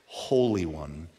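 usual speaking voice

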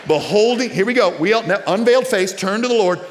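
high, loud, ringing preacher's cry; rising preaching at full throttle; full-force preaching. Real, not acted. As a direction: lift the room with wonder and conviction.

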